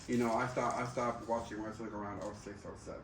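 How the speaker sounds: normal talking voice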